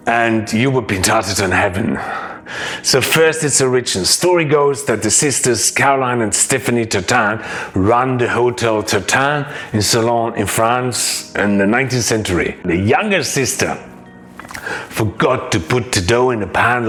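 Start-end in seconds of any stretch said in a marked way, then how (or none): none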